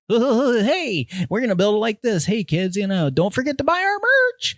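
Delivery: mocking fake influencer. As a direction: parody a polished phony streamer hawking merch. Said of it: "the assholes that are out there being like".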